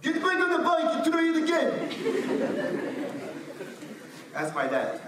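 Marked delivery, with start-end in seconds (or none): 0.0-1.7 put-on much higher, louder, fast Moroccan-accented dad. Imitating his Moroccan dad telling him to ride again, Moroccan accent. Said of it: "But with Moroccan voice". 1.8-4.3 laughing. Real, not acted. Laughing after his dad impression.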